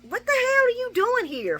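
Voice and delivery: Lucy confronting the unwanted guest, furious; indignant yell. Retold or imitated. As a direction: demand she explain herself. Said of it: "I'm not mad at Lucy for being like".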